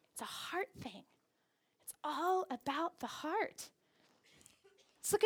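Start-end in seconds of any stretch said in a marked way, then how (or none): 0.1-3.7 very soft, near-whispered emphasis; hushed. Real, not acted. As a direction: drop to a hush for the key point. Preacher hushes for key point.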